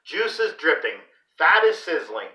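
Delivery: ordinary delivery, nothing marked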